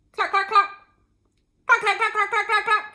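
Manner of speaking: hen clucking. A narrator's reading.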